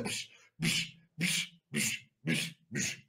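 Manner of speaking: soft voiceless whoosh bursts; mimed push sound effects; imitated shoving. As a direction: puff out a series of pushes. Imitates pushing both men away.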